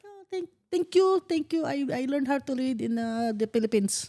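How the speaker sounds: put-on high, bashful thanks in a Filipino accent. Boy fresh from the Philippines thanks classmates, Filipino accent. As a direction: thank them sweetly with a thick Filipino accent. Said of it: "Still had the accent".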